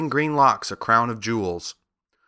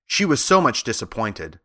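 plain reading voice

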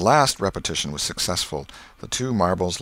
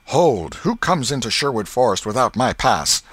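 high, loud challenge; calling out; 5 semitones higher, 5 dB louder than usual. A narrator's reading. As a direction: call out a bold challenge. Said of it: "Tom called".

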